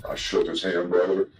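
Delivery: normal talking voice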